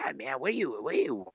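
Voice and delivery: high, exasperated whine of Steve-O; exasperated comedian. Retold or imitated. Comedian fed up with heckler, mock whiny pleading. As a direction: plead with the heckler, half laughing. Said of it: "And Steve-O's finally like".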